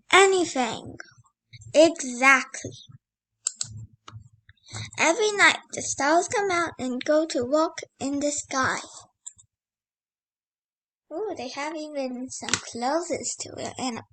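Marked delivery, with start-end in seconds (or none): none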